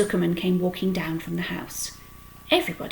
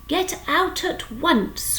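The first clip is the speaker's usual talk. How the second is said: high, slow, stern command; scolding mother calling out; mother crying out; 10 semitones higher, much slower than usual. A narrator's reading. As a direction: order the boy out at once. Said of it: "cried Missus Arable".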